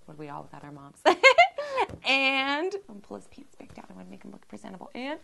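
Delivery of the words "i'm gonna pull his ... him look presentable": quick unvoiced whisper; hushed murmur to herself; whispered aside. Real, not acted. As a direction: whisper it fast, like a secret. Aside while dressing doll.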